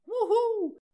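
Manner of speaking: very high, loud falling wuhuu cheer; whoop. Acted. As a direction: cheer like a fan at a concert. Cheer after each patron thanked.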